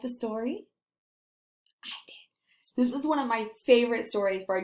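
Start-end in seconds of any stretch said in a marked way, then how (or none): 1.8-2.7 soft unvoiced whisper. Real, not acted. Teacher answering her own question, whispered. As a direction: breathe it out secretly.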